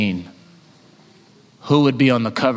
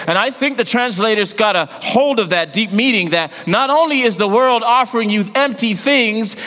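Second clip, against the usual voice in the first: preaching at full force; 11 semitones higher than usual. Acted. Preacher warning the congregation about empty things, full preaching voice. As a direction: drive the lesson home to the church. Preacher driving home the point.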